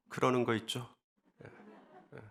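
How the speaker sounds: ordinary talk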